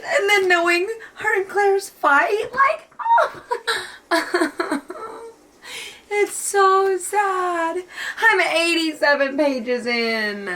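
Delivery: very high, drawn-out crying voice; tearful wail. Real, not acted. Reader overwhelmed early in a sad book, crying. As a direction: wail it out through real tears.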